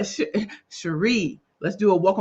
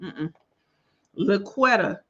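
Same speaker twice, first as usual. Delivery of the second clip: ordinary talk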